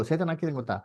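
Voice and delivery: normal talking voice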